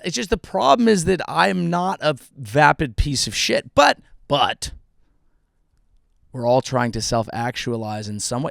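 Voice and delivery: ordinary talk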